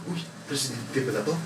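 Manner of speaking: soft aside among themselves; surprised murmur. Retold or imitated. Parents murmuring on learning he is president, hushed. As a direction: mutter it in quiet surprise.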